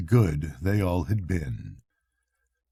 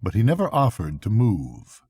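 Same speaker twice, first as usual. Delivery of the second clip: ordinary delivery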